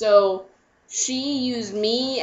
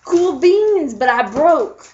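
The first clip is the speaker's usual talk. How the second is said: louder, higher "cool beans"; dopey boyfriend; 5 semitones higher, 6 dB louder, slower than usual. Retold or imitated. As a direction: play a laid-back dude. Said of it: "And he goes".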